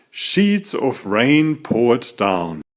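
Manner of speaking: ordinary delivery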